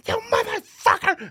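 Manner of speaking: high strained mock scream; retold scream. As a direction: scream it like a night-terror outburst, acted. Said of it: "I just started screaming at him".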